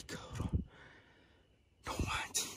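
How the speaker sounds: susurro sin voz, con pausas; susurro atónito. Real, not acted. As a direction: susurra para ti mismo, impactado. Impactado tras huir la criatura. (susurrando, pasmado)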